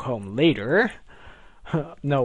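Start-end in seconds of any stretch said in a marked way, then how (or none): none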